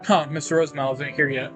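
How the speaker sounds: usual speaking voice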